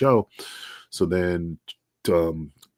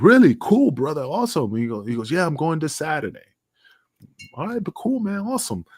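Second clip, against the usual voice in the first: excited reaction; 8 semitones higher than usual. Retold or imitated. Cheering on the manager's referee job, much higher and thrilled. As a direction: pop up high with delight.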